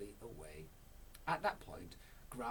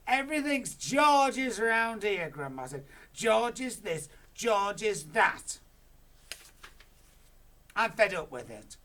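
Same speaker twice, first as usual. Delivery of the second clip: Grandma grumbles loudly about George; jealous grumble; 11 semitones higher, 16 dB louder than usual. Acted. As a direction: spit out the name.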